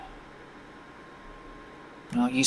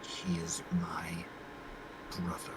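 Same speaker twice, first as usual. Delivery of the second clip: trembling whisper; 8 semitones lower, 16 dB quieter than usual. Acted. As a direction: whisper it haltingly, barely audible. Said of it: "He will, trembling, whisper".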